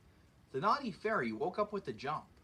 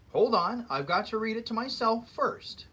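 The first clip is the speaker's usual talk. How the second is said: loud, higher mock wolf growl; 5 semitones higher, 8 dB louder than usual. Wolf holding off the giant, loud mock growl. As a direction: snap it out impatiently, hammed-up wolf. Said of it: "growled the big bad wolf".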